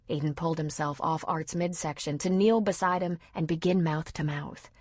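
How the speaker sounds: ordinary delivery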